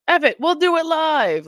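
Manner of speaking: crazed, high, loud outburst, imitated; mock an on-air temper blow-up; Bill O'Reilly. Host imitating a TV anchor known for his temper, crazed yelling. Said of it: "Like, he was crazy".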